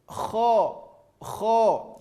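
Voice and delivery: KHO with a full rounded o; throaty kh into a round "o" in KHO; thick kho. Shown as a demonstration. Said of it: "huruf tebal adalah huruf O".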